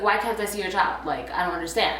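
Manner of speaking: ordinary talk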